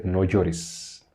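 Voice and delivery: hushed, simple reassurance; Jesus's gentle command. Acted. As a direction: say it low and quiet as Jesus consoling. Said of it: "Y Jesús, eh, le dijo a la mujer".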